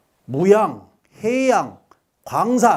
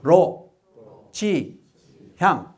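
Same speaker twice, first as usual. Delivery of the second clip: one syllable at a time, pausing after each; leading a repeat-after-me; much slower than usual. Shown as a demonstration. Lecturer teaching the audience a phrase, syllable by syllable. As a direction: say "추, 로, 지, 향" separately for the class to echo. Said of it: "따라합시다".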